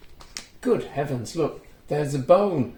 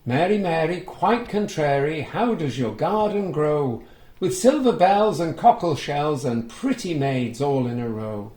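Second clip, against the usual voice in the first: sing-song nursery rhyme reading; rhyme recital; 2 semitones higher, 5 dB louder than usual. A narrator's reading. Reading the rhyme to children, sing-song.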